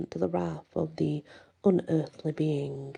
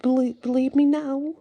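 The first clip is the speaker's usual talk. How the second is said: high, loud, stammering quiver; 9 semitones higher, 5 dB louder than usual. A narrator's reading. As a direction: stumble into a raised, quivering challenge. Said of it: "Jason's voice quivered".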